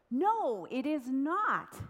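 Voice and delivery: high, loud, stretched-out protest; indignant shout. A narrator's reading. Wounded king shouting back at his friend, outraged. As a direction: shout it back, drawing out NOT. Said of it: "shouted the king in response".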